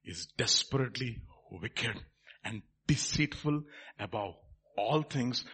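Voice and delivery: low, soft, solemn preaching; hushed exhortation. Real, not acted. Preacher urging congregation to let truth probe hearts, hushed. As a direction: urge them quietly and gravely.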